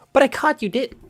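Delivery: put-on trial girl voice. Player testing a voice for Sayori, high girl voice. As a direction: try out a high voice for a female character. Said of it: "What voice should I do?".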